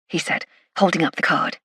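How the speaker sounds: usual speaking voice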